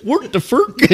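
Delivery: high, laughing mock chef gibberish; sing-song Muppet chef nonsense; giggling chef babble; Swedish Chef impression. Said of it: "Like the Swedish Chef?".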